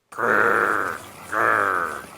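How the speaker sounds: gritty mechanical growl; growly grinding noise. Acted. Sharpener grinding.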